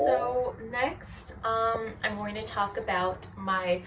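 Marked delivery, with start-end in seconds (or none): none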